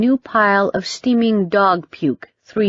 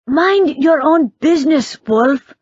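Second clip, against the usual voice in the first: snappy retort; 9 semitones higher, 4 dB louder than usual. A narrator's reading. Teen snaps back at Derek.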